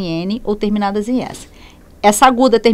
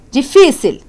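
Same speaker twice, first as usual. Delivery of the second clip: Difícil with FÍ raised and loud; stress on penultimate syllable; 5 semitones higher, 5 dB louder, slower than usual. Shown as a demonstration. Teacher locating the tonic syllable, Difícil stressed on FÍ. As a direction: hit the FÍ in Difícil. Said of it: "sílaba tônica".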